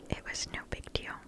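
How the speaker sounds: ordinary talk, nothing marked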